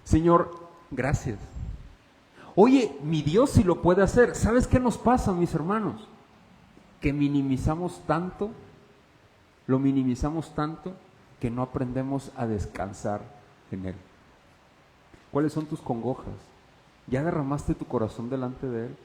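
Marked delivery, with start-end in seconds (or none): none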